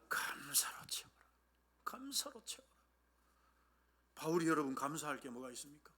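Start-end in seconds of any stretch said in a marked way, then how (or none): 0.1-2.7 very soft whispered exhortation; whispered answer. Acted. Preacher answering how to fill prayer, whispered. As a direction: whisper it like a precious secret. Hushed answer to loud question.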